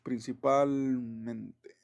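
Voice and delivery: slow, stretched word while writing; drawn-out dictation. Real, not acted. Teacher writing for the class, drawn-out dictation. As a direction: draw the word out as you write it.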